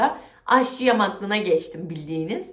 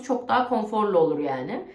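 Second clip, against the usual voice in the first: normal talking voice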